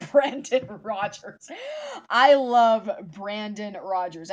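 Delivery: loud, slow gushing; excited. Real, not acted. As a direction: gush about him, drawn out. Gushing about favourite YouTuber.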